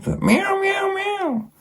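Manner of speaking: high squeaky cat miaows; mock miaow.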